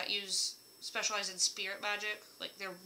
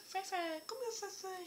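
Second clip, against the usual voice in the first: much higher, soft call to a pet; calling the dog; 10 semitones higher, 8 dB quieter than usual. Retold or imitated. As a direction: coax the dog over sweetly. Calling her old dog.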